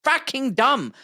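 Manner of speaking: slow, drawn out, rising high on the last words; exasperated shout. Retold or imitated. Fed up with brainwashed people, a slow, high exasperated yell. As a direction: spell each word out like shaking someone awake. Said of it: "I wanna shake people and say".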